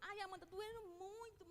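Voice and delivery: very soft, aching voice of a mother in pain; pained moan.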